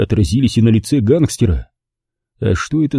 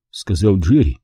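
ordinary delivery, nothing marked

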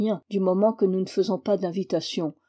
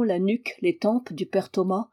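usual speaking voice

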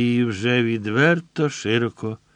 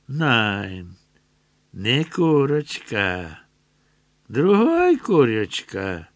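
slow, louder leering soldier voice; German soldier; 5 dB louder, slower than usual. A narrator's reading. Soldier eyeing Solomiika, slow and leering. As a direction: drag the words out with a leer.

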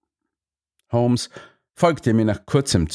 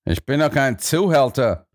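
higher, affronted protest; indignant; 5 semitones higher than usual. A narrator's reading. Stanley denies being a pimp, indignant. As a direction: deny it with mild outrage. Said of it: "rief Stanley empört".

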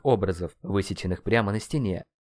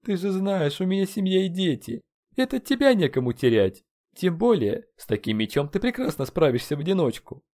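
high, plaintive put-on voice; crestfallen excuse; 9 semitones higher than usual. A narrator's reading. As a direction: beg off plaintively, dejected. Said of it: "произнёс упавшим голосом Талтенолли". (жалобно отговаривается высоким голосом)

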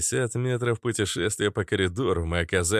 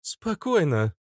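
higher-pitched, mildly raised command; loud command; 8 semitones higher, slower than usual. A narrator's reading. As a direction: call for calm, only slightly raised. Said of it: "громко сказала Иштар". (чуть повышенным голосом, властно)

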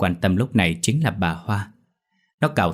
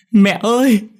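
high, loud anguished wail; crying scream; 14 semitones higher, 7 dB louder, slower than usual. A narrator's reading. Dậu seeing his house burning, screaming sob. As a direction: scream for mother in tears. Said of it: "Dậu khóc thét lên".

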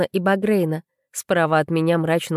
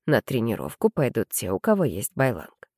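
low, patient explanation; 7 semitones lower, 4 dB quieter than usual. A narrator's reading. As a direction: explain it patiently to a stubborn girl. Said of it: "терпеливо пояснил".

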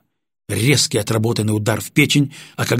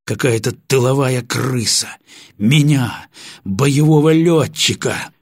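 indignant fuming; 3 semitones higher, 3 dB louder, slower than usual. Acted. Pilot fumes, still angry.

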